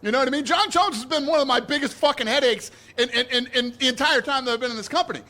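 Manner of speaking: press scrum. Real, not acted. [name] raised voice.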